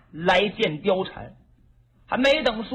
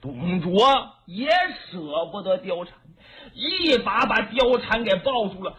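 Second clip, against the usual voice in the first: plain reading voice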